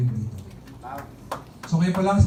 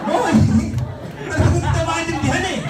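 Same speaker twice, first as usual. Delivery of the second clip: exasperated father; 10 semitones higher than usual. Retold or imitated. Father giving up on the customer, high exasperated cry. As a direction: shriek it in disbelief. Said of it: "Sabi ng tatay".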